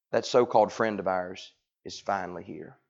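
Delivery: a hint of bitter indignation; mild indignant. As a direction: Martha grumbling about Jesus, lightly indignant. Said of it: "indignant nature".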